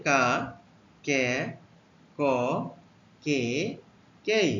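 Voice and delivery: কা, কে, ক, কে, কেই said one by one, slow and clear; teacher modelling কা কে ক কে কেই; letter sounds demo. Shown as a demonstration. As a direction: spell out কা কে ক কে কেই with a pause after each. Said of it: "এই উচ্চারণগুলো হতে পারে".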